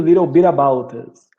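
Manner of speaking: "little bit about us" blended as "lirow birabout us"; linked together. Shown as a demonstration. Teacher blending the whole phrase so students hear it joined. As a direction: join "little bit about us" into one flowing line with tapped Ts. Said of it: "Quando junta tudo".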